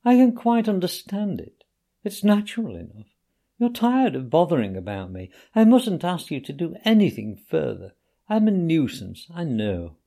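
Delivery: mournful invalid laying it on; lightly voiced self-pitying Toad. A narrator's reading. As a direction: Toad moaning to Rat about his condition. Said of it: "replied the Toad languidly".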